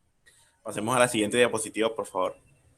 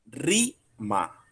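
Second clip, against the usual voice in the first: "rima" split in two, RI raised and drawn out; stress on RI. Shown as a demonstration. Teacher shows the class where the force falls, stress on RI in "rima". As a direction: lean on the RI of "rima", let ma drop. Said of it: "La fuerza de la palabra está en la I".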